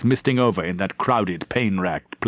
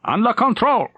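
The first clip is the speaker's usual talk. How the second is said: high, raised battle report over gunfire; shouted report; 12 semitones higher than usual. Acted. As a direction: call it out over the din. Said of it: "Frober shouted".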